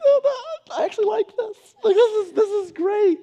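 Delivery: high, loud, gushing delight; melting parent. Retold or imitated. Parent hugged by sorry child, gushing. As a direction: gush in a squeezed high voice.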